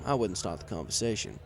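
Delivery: ordinary delivery, nothing marked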